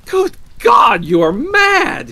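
high, horrified shout; aghast. A narrator's reading. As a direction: cry out appalled, loud and high. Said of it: "he cried aghast".

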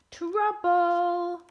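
loud, high, drawn-out alarm; storyteller sounding the alarm; dramatic announcement. A narrator's reading. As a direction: proclaim it as sudden disaster. Sudden trouble announced in story.

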